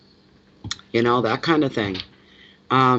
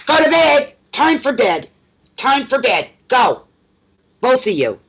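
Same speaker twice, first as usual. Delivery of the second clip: high, raised-voice telling-off; exasperated scolding; 9 semitones higher, 3 dB louder than usual. Real, not acted. Owner sending two squabbling pets to bed, high exasperated scolding. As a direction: order naughty pets off like a fed-up mother.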